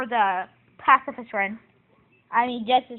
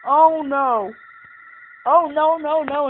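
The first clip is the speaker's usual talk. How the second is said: alarmed; 3 semitones higher, 9 dB louder, slower than usual. Real, not acted. Watching something go wrong in the game, loud dismay. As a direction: cry out in dismay.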